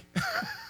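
a quick laugh at the mock complaint; high laugh. Real, not acted.

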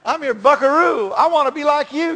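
put-on high, loud country twang as an adoring kid; hammed-up twangy little cowboy. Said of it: "You gotta say it with a twang".

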